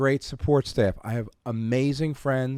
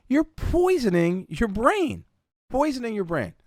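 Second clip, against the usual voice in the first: emphatic warning; 10 semitones higher than usual. Retold or imitated. Retold scolding of agent.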